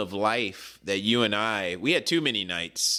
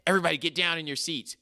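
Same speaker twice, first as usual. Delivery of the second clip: quick, hushed rush of an order to duck; urgent order; 6 semitones higher, much faster than usual. Retold or imitated. Friend orders everyone to duck from the cop, fast and urgent. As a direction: snap it out fast so everyone hides.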